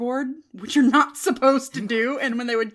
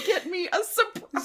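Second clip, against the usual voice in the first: high mock child's plea, breaking into laughter; desperate needy child; 8 semitones higher than usual. Echoing the child's plea to mom, mock-desperate. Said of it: "desperate voice that, like, only a needy child can have".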